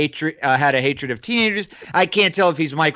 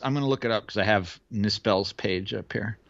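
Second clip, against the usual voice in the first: whispering; 6 semitones lower, 7 dB quieter than usual. Real, not acted. Whispers while looking it up.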